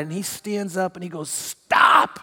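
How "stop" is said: sudden explosive "enough" shout; fed-up shout. Retold or imitated. Another dad cuts off the ranting father, fed-up shout. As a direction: shout it like someone who has had it. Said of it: "another dad had had it".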